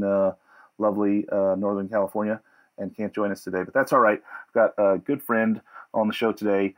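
usual speaking voice

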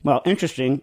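ordinary delivery

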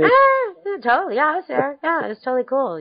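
high, breezy fake bragging; mock boastful. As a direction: play along with a fib. Pretending she attended too.

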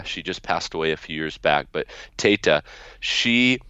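normal talking voice

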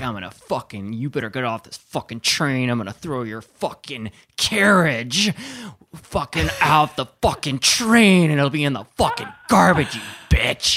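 loud, slow, building threat; retold threat. Drunk man threatening to toss the woman's cart, building loud. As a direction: menace her out the train. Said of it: "he's like".

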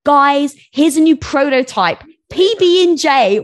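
high, loud product pitch, stretching the last words; mock launch-day hype; hyped announcement. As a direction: announce it like a big reveal.

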